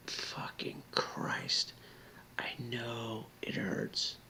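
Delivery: slow, broken whisper; choked whisper. Real, not acted. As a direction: barely get the words out. Overcome while comforting viewer.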